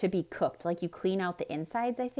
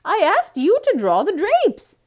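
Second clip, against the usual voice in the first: upset Missus Rogers; 12 semitones higher, 17 dB louder than usual. A narrator's reading. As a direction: wail at the maid in dismay. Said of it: "said Missus Rogers".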